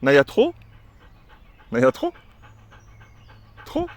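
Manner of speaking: loud, rising "trop"; sharp command. Shown as a demonstration. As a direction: snap the word at the dog. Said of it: "je lui dis". (lancer « trop » sèchement à la chienne)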